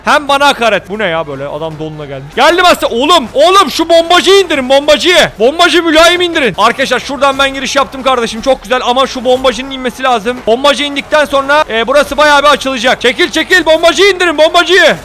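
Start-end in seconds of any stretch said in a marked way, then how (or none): 2.4-6.5 higher, louder battle command; shouting orders. Real, not acted. 13.0-15.0 higher, louder battle command; shouting orders. Real, not acted.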